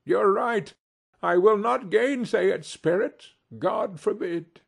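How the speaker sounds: high, emphatic agreement; earnest cry. A narrator's reading. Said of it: "cried Scrooge".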